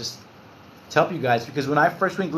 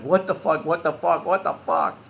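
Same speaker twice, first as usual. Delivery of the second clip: retold panic, short of breath; re-enacting a winded moment; acted-out breathlessness. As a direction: repeat it as if struggling for air. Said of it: "I couldn't catch my breath".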